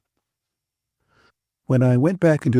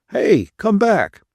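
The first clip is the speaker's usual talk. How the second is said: higher, slow drawn-out call; 8 semitones higher, 3 dB louder, slower than usual. A narrator's reading. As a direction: call him back slowly across the room. Bill calling out.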